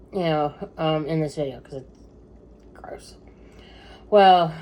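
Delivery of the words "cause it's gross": whispered gross. Real, not acted. Vlogger warning viewers, whispering that it's gross.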